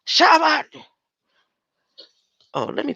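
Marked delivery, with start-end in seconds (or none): 0.1-0.7 Shabbat shouted high and loud. Real, not acted. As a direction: yell out Shabbat with a rising pitch. Shouts the word.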